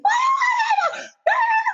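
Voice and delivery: high, drawn-out wordless wail; crazy-person scream. Retold or imitated. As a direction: scream like you've lost it, retold. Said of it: "I sound like a crazy person".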